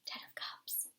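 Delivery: barely audible whisper; hushed, very soft card reading. Real, not acted. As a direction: whisper it to yourself. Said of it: "just whispering that".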